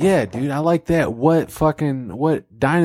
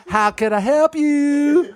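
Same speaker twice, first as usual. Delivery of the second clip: higher, slower, laughing; high God voice; 11 semitones higher, slower than usual. Acted. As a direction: stretch it out high and polite.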